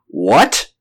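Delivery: doctor caught off guard by the news; surprised cry. Acted. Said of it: "cried the doctor in surprise".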